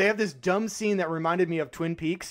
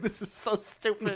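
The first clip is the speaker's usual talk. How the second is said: laughing disbelief; 4 semitones higher, 6 dB quieter than usual. Retold or imitated. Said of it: "I was like".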